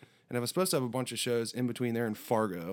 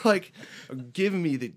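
normal talking voice

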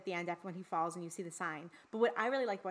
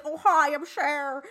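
slow, loud mock [name] voice; a hammed-up [name] drawl; [name] impression; 5 semitones higher, 10 dB louder, slower than usual. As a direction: drag it out like Jujubee doing [name]. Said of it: "Jujubee doing a [name] impression".

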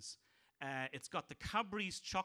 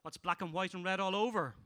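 loud, higher punchline voice; stand-up joke telling; 5 semitones higher, 4 dB louder than usual. Acted. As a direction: sell each gag to the crowd. Comic telling jokes.

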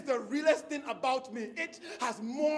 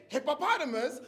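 "hippopotamus" said the American way; American pronunciation. Shown as a demonstration. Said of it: "You say".

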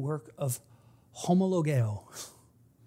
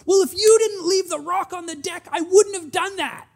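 put-on high, loud accusing child shout; child blaming parent for his hurt foot, shouting; child yelling blame; 13 semitones higher, 9 dB louder than usual. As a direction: yell the blame like an upset kid. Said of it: "yelling at me".

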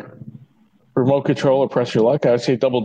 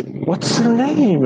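slow searching; 10 semitones higher, much slower than usual. Real, not acted. Forgetting an actress's name.

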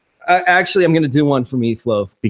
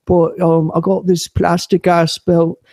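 put-on butch Irish wrestler; Irish wrestler voice, slowing as it goes; Becky Lynch. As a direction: ramble in a mock-Irish impression. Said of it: "my impression this week is Becky Lynch".

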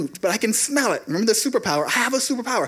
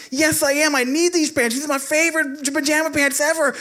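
put-on stubborn child; 8 semitones higher than usual. Boy refusing to give up his favorite pants, high stubborn whine.

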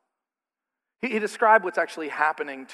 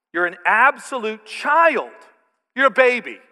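louder, higher mock scolding; sarcastic scolding; 4 semitones higher, 6 dB louder than usual. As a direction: sneer it like Paul's sarcasm toward a childish church. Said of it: "he's using some, some sarcasm here".

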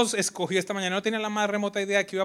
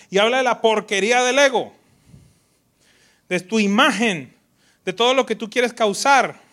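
much louder, higher emphatic preaching; forceful preaching; 6 semitones higher, 11 dB louder than usual. Real, not acted. Pastor preaching against ego, forceful and loud. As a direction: drive the point home.

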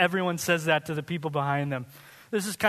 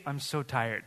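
tired sigh; 6 semitones lower, 6 dB quieter than usual. Retold or imitated. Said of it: "story arc".

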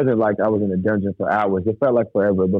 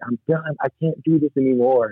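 higher, strained plea without volume; retold desperate cry; 6 semitones higher than usual. Man in the freeway crying out to God, retold desperate plea. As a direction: reenact a despairing scream at restrained volume. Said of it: "I'm screaming at God".

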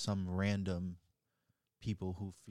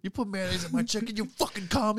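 high, loud mock-furious shout; angry yell; 14 semitones higher, 9 dB louder than usual. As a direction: yell like an outraged customer. Mocking angry customer voice.

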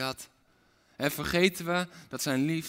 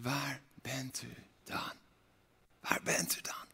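softer, whispered searching plea; mock cry out, whispered; 3 semitones lower, 10 dB quieter than usual. Seeker at the empty grave, hushed plea. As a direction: call out but only in a whisper. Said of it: "je riep uit".

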